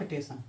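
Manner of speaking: टेसन, dropping the s and using a plain s; clipped pronunciation. Shown as a demonstration. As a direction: say टेसन as tesan, no initial s. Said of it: "कोई बोलता है टेसन". (बोलचाल वाला टेसन)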